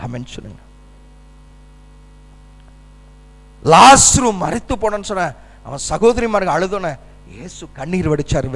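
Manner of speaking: low, hushed words of comfort; tender preaching. Real, not acted. Tender assurance of God's love.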